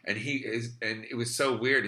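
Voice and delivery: usual speaking voice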